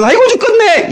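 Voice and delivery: high-pitched mock cry of dread; hammed-up panicked lament; timid person's wail. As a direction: wail it like a faint-hearted worrier. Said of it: "소심한 사람들은".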